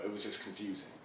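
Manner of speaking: normal talking voice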